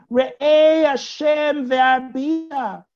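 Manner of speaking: loud, drawn-out high plea; cutting in. Acted. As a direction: call out over him, stretching the words. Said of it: "She cuts him off again".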